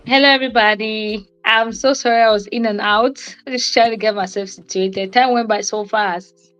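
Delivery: ordinary talk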